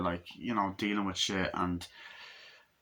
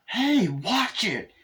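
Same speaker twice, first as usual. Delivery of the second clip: much higher, slow drawn-out warning; mock yell; 13 semitones higher, 4 dB louder, much slower than usual. Annoyed beachgoer yelling at whoever threw the ball. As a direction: yell at the careless kid.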